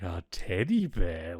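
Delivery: slow, drawn-out "A teddy bear?" with a grin; McCoy gleefully echoing the teddy bear news, slow and amused; delighted disbelief. Retold or imitated. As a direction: savour "A teddy bear?" like he's found ammo. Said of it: "He's like".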